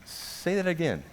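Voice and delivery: soft, high, careful request; imitated puzzled friend. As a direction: ask it quietly, in disbelief. Baffled friend asks again.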